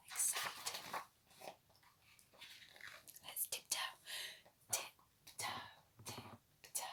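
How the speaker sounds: very soft whisper. A narrator's reading. Storyteller to children, a hushed whisper. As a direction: tiptoe with your voice.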